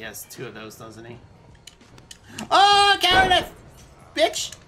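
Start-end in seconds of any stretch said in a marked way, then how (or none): 2.5-4.5 high, loud outcry; indignant shout. Real, not acted. Player accusing the opponent of cowardice, shouting. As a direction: holler it at the opponent.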